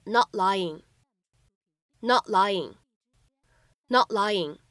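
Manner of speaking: "not lying" linked, the t in "not" held unreleased; incomplete plosion. Shown as a demonstration. Teacher models linking for learners, "not lying" with an unreleased t. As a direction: stop the t of "not" silently before "lying". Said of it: "我们可以有一个不完全爆破".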